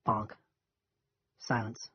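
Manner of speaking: plain reading voice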